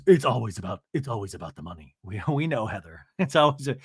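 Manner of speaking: low, then sinking to a near-mumble; low mock Heather. Podcaster mimics Heather droning on about money. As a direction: lightly imitate a weary Heather. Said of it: "Heather goes".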